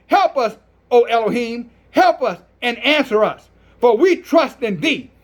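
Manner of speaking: ordinary talk, nothing marked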